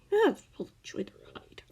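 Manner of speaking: whispered and quick; wry whisper. A narrator's reading. Narrator sharing Scar's wry thought, whispered. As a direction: slip it out as a secret aside.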